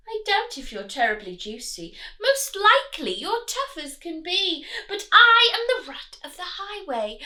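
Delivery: bully them like a greedy rat; big villainous rat; highway rat voice. Acted. Rat sings his boast.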